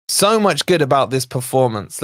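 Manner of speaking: normal talking voice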